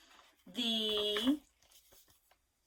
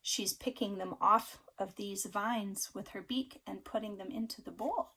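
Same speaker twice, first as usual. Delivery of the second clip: ordinary delivery, nothing marked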